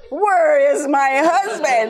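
loud, slow mock-furious demand; acted angry outburst. Wife imagines grabbing the clerk, acted angry outburst. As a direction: demand it loudly, drawing out each word in exaggerated outrage. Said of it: "tell you about reaction".

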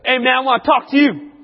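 loud, high confrontational shout; imitated yell. Classmate confronting him after class, imitated yell. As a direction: yell it out like an angry challenger. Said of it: "he yells at me".

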